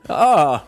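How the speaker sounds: mock eureka cry; sudden realization. Retelling the wizard finding the way, exclaimed "ah".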